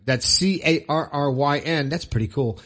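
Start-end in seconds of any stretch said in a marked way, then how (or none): none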